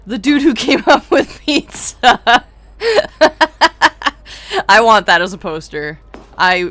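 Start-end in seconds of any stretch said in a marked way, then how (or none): 0.1-3.2 slow, high, choked with laughter; laughing hard. Real, not acted. Streamer reads a ridiculous audience title, cracking up laughing. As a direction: squeeze the words out through laughter.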